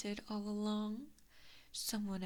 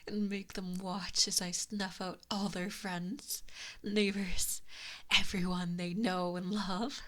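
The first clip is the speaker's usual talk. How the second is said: louder, darker, relishing the cruelty; menacing threat; 2 semitones lower, 5 dB louder than usual. Acted. Fox spirit threatening the human's family, growing menace. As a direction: let the menace swell.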